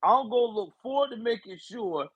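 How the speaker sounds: mock Joe Biden making a firm campaign promise; strong Biden voice. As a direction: pledge it like a hammed-up president. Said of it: "Biden with the strong voice".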